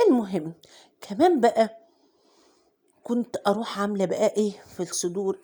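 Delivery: ordinary talk, nothing marked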